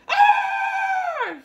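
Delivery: high loud scalded howl; wolf splashing into the pot, yelping; wolf's pained yelp. A narrator's reading. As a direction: yelp like a wolf landing in hot water. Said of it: "he cried".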